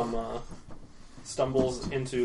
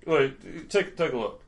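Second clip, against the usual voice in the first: normal talking voice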